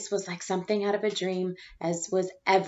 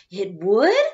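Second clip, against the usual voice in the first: much louder, amazed question; surprised; 9 dB louder than usual. Acted. Rita amazed at the teacher, much louder. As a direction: gasp it out.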